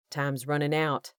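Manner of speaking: plain reading voice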